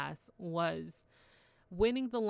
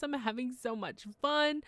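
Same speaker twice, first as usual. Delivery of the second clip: excited; 7 semitones higher, 5 dB louder than usual. Retold or imitated. Retelling her own excited thought.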